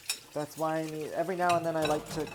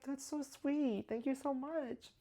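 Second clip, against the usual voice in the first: higher, slow, gushing thanks; sweet thanks; 11 semitones higher, 4 dB quieter, slower than usual. Retold or imitated. Thanking the cashier, high and slow, touched. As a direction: melt with grateful thanks. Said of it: "I was like".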